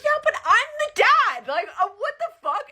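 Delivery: very high, loud, slow squeal of outrage; hammed-up furious dad, nearly laughing; mock outraged husband. Husband yelling at his wife that he's the dad, high and loud.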